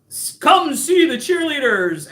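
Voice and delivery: booming ad announcer. Retold or imitated. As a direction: pitch it like a sports promo. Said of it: "He was like".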